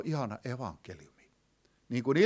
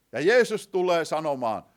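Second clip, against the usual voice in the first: ordinary talk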